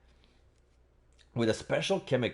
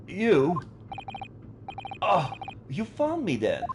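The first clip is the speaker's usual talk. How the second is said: hero voice; 9 semitones higher than usual. Acted. Player voices Ryunosuke confronting the Russian, startled. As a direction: caught and resigned. Voicing Ryunosuke facing captors.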